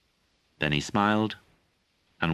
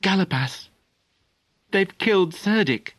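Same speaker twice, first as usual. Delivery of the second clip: much higher, loud urgent outburst; distressed cry; 14 semitones higher, 3 dB louder than usual. A narrator's reading. Young Merlin cries out to Galapas, distraught. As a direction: blurt out the bad news. Boy bursts out terrible news.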